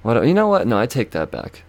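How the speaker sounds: ordinary talk, nothing marked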